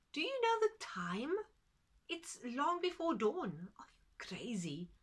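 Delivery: woken farmer telling off his friend; annoyed. Acted.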